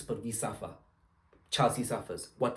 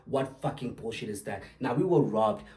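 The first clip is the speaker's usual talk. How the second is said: ordinary delivery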